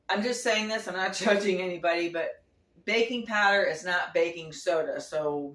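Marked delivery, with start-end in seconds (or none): none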